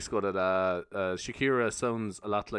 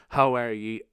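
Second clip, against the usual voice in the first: ordinary delivery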